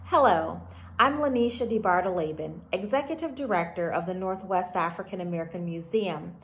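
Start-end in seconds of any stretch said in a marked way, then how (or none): none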